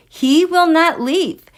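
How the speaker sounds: "He will not leave" loud, high and slow, each word weighted; emphatic insistence. Real, not acted. Host exasperated that Boris is still there, loud emphatic insistence.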